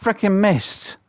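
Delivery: loud, high cry of frustration; frustrated outburst. Real, not acted. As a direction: vent the miss at full volume. Hunter just missed the shot.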